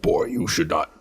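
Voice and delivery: low, gruff stern warning to son; lightly voiced god of war growl; Kratos voice. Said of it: "That was Kratos".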